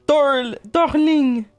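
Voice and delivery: loud, high-pitched mock French accent. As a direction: exclaim it playfully, hammed-up French accent. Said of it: "say it with your lovely French accent".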